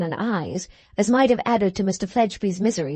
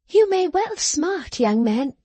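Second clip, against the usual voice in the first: much higher, slow, secretly gleeful; gleeful exclamation; 6 semitones higher, slower than usual. Acted. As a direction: relish his pain while pretending pity. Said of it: "exclaimed Miss Jenny".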